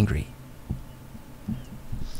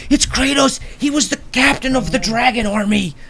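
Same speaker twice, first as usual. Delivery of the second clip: put-on excited kids; 12 semitones higher than usual.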